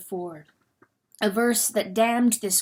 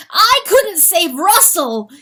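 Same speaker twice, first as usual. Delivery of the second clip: loud, higher anguished cry; 9 semitones higher, 10 dB louder than usual. Acted. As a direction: cry out in guilt. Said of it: "he cried".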